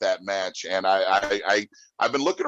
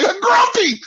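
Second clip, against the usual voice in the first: gleeful shout; 8 dB louder than usual. Retold or imitated. Gleeful retold reaction to Depp.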